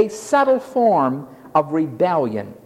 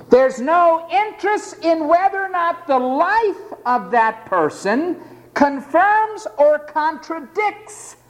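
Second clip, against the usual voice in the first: indignant rise; 9 semitones higher, slower than usual. Acted. Preacher rises in indignation.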